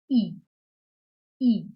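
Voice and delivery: E said as "ee"; German letter I. Shown as a demonstration. Teacher showing learners the German alphabet, I as "ee". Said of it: "is pronounced as".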